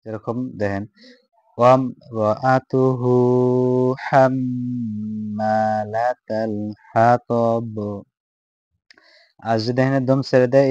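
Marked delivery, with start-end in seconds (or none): 1.6-7.8 slow, drawn-out chanted recitation; slow tajweed recitation in Arabic; melodic Quran recitation. Shown as a demonstration. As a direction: chant each syllable long and sung. Recites verse after explanation.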